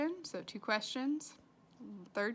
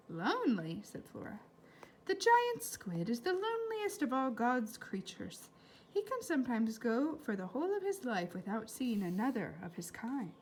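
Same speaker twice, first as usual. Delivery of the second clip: put-on lively read-aloud to children; story reading; 4 semitones higher, 5 dB louder than usual. A narrator's reading. Teacher reading a novel aloud to her class, lively. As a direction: bring the chapter to life for the class.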